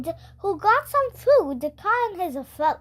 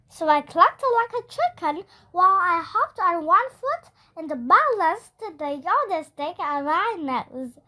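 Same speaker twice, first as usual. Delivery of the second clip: usual speaking voice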